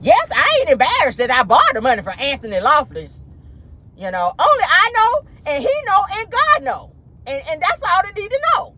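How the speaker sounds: high, defensive impression of Keke; imitating Keke. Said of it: "She was saying".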